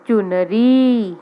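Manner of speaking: चुनरी stretched very slowly, each syllable long; slow sounding out. Shown as a demonstration. Teacher to young pupils, saying चुनरी slowly. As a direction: draw out चुनरी for beginners. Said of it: "teacher sounds out word".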